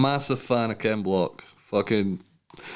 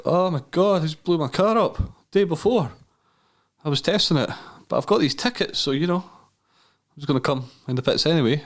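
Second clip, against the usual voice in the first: much higher, hammed-up flustered excuse; mock panicked plea; 7 semitones higher than usual. Imagined telling Monster his car blew up, in a high mock panic.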